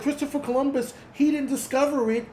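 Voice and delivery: high, lightly voiced mocking whine. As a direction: parrot the complaint in a high sneering voice. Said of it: "you're like".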